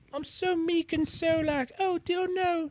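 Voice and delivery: high, hammed-up damsel voice; mock meek princess. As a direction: simper like a helpless damsel. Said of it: "some games she's like".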